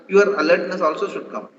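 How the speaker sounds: ordinary delivery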